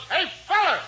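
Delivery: high-pitched mock holler. Questioning how a manager summons his pitcher, hollering. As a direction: call out like a team manager summoning a player. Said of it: "You mean he just hollers".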